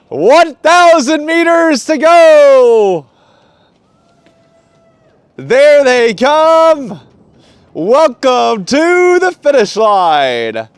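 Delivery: loud, high, slow race announcer. Pretend. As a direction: call the finish like a big event announcer. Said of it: "Working on my announcer voice".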